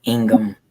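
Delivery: Ingham said as ING-um, H and A silent; local pronunciation. Shown as a demonstration. Telling a friend the county name, Ingham as ING-um. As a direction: say Ingham as two syllables, ING-um. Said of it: "You don't pronounce the H A".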